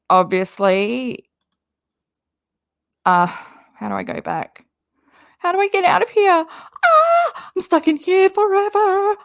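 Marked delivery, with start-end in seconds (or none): 5.4-9.2 high-pitched play-acted panic, rushed at first; mock panic. Streamer pretends to be trapped in an Instagram page, mock panic. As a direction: wail in pretend terror, much higher than usual.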